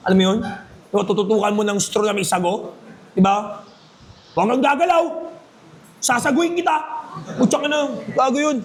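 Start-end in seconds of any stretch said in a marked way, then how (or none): 4.3-8.6 higher, hammed-up cop shouting an order; mock police threat. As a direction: play a silly cop aiming a straw. Mock cop with straw gun. (pulis na kunwaring nagbabanta, pabiro)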